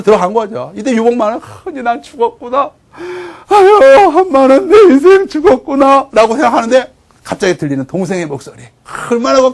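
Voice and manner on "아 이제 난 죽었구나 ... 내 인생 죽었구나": hammed-up lament of the doomed; despairing lament. Condemned prisoner bemoaning his end, despairing lament.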